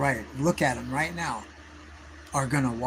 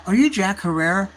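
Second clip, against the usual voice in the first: eager blurt; 5 semitones higher, 6 dB louder than usual. Retold or imitated. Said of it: "I blurted it right out".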